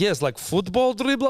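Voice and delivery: high mock Croatian-accented reply. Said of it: "She's like".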